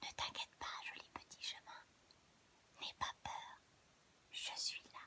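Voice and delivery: whispered, tender comfort; barely audible gentle whisper; soft reassurance. Acted. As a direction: soothe a scared child in a whisper. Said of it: "comme on parle doucement à un enfant".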